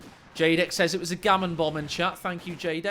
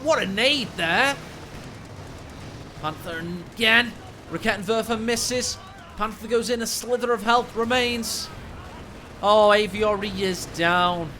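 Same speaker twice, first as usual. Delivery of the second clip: louder rising commentary, building to a shout; excited play-by-play; 3 semitones higher, 4 dB louder than usual. Real, not acted. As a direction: call the duel as it swings. Caster calling a tank duel.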